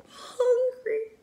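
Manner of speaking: high, crying whimper. Acted. Starving dragon sobbing on the ground, high crying whimper. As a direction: wail it like a sad hungry dragon. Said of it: "he whimpered".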